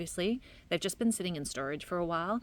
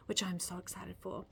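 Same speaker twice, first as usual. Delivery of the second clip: whispering; 9 dB quieter than usual. Acted. Excited aside about weekend.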